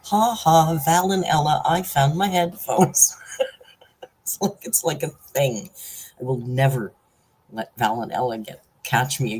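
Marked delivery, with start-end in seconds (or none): 0.0-3.2 loud, slowing gloat over a small victory; mock laughing triumph. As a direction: crow it out like a retold victory cry. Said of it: "And I'm laughing. As I'm ... laughing, going".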